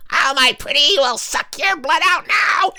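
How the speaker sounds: very high, screeching storybook witch; hammed-up wicked witch. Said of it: "a coven of witches".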